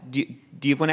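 ordinary talk, nothing marked

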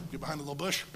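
quick, scheming mutter; a timid fighter plotting to creep up; sneaky rushing. Retold or imitated. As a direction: rattle off a sneaky plan fast. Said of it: "He went like".

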